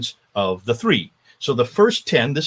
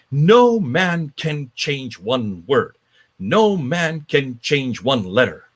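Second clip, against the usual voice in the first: slow, weighty emphasis on each word; spelling out the dogma slowly; slow emphatic; much slower than usual. Real, not acted. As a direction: lay down no man can change one word slowly. Stressing doctrine slowly.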